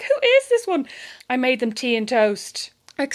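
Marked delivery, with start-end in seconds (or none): 0.0-0.9 imitating the bemused guests; mock puzzled guests. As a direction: squeak out a baffled question. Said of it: "I'm sure they're like".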